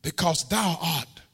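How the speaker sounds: high, loud, drawn-out whooping sermon; full-force preaching. Acted. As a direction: preach the psalm to a shouting climax.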